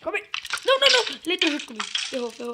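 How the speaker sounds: screaming as the mob corners him; panicked screaming. Real, not acted. (gritos de pânico agudos)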